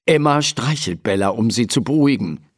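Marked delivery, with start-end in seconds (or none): none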